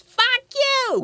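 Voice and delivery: very high squealed Boston seal voice; Hoover. Acted. Storyteller faking seal dialogue for listeners, squealed Boston accent. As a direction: shriek it as a foul-mouthed Boston seal. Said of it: "that's what Hoover the Seal said".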